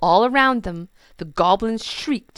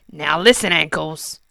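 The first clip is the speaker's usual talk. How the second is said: bossy goblin king; 7 dB louder than usual. Acted. King giving orders.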